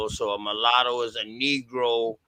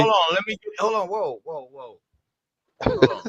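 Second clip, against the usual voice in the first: shouting hold on; 5 semitones higher than usual. Real, not acted. Caller shouts over host.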